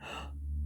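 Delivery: sudden breathy gasp; sharp gasp of inspiration. A narrator's reading. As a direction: gasp as the idea strikes. Mister Silly gets an idea.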